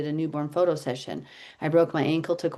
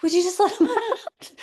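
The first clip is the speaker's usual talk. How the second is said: pleading; 11 semitones higher than usual. Retold or imitated. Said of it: "And I kept saying".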